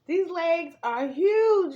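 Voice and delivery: slow, loud, drawn-out marvelling; amazed. Real, not acted. Showing viewers the huge legs, slowly amazed. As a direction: stretch it out in awe.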